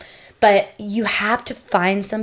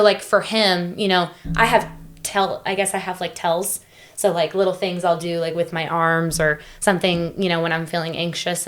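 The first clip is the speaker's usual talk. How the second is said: ordinary talk, nothing marked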